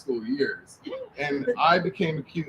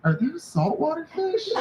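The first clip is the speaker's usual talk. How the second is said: high question through laughter; laughing question. Retold or imitated. Brother asking about the fish, laughing high. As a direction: ask it while cracking up. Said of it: "looks at him and goes".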